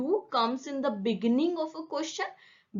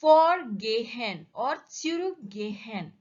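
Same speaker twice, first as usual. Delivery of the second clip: "Vorgehen" with a long, strong VOR, "zurückgehen" leaning on ZURÜCK; slow, prefix-heavy "Vorgehen or zurückgehen"; stress on the prefixes. Shown as a demonstration. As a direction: punch "Vor" and "zurück", let each "gehen" fall. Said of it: "on a prefix".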